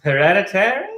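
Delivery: feed the punchline like a stage partner; vaudeville bad acting. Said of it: "Let's deliver it like we're in, in vaudeville".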